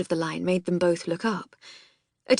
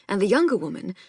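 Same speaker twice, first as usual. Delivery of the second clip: ordinary delivery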